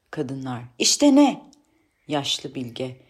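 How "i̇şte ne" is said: high, louder challenge; indignant demand for an explanation; challenging retort. Acted. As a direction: throw the question back sharply. Women challenge the sage.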